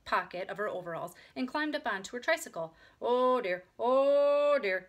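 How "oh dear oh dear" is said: loud, drawn-out wheezy moan; moaning harmonica. A narrator's reading. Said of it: "she inhaled and exhaled".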